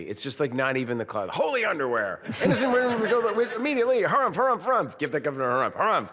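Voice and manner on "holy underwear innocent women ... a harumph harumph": high, fast, hammed-up pompous governor; high, fast mock-official harrumphing; Blazing Saddles quote. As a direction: bluster it out like a blowhard politician. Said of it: "every line in that movie".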